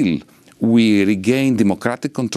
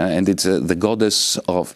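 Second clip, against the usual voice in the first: ordinary delivery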